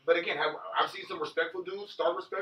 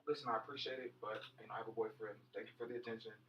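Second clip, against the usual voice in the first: very soft, lower, polite let-down; respectful brush-off; 7 semitones lower, 18 dB quieter than usual. Retold or imitated. Woman politely declining a young catcaller, softly respectful. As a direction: imitate a woman gently turning him down, hushed. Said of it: "she was so respectful".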